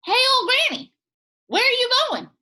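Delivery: high, loud calling nut; pecan voice. Acted. Pecan nut calling to Granny, high and loud. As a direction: call out high like a tiny talking pecan. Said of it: "it spoke to her".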